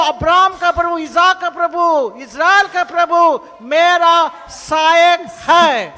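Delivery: loud, high proclamation; shouted declaration. Shown as a demonstration. Pastor showing the church how to shout it, loud and high. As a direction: belt it out like a battle cry. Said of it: "जोर से चिल्ला के बोलो".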